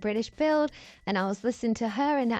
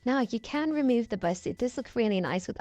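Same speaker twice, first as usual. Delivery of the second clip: normal talking voice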